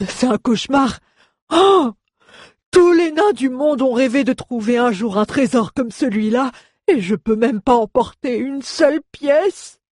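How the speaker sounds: very high, despairing dwarf wail; dwarf's whining lament. A narrator's reading. Dwarf carried away from a dream treasure, lamenting. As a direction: moan high, bursting out on the cry. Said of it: "se plaignit le nain".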